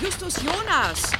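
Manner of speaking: high, drawn-out distant call; far-off summoning call; calling from afar. Acted. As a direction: holler a name across the scrapyard. Aunt calls out across the scrapyard.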